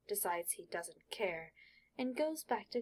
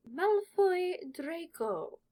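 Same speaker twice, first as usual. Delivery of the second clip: slow, higher, louder stern professor calling a name; McGonagall voice; 9 semitones higher, 8 dB louder, much slower than usual. Acted. Stern professor calls Draco forward to be sorted, slow and loud. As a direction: draw the name out like a strict teacher. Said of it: "says Professor McGonagall".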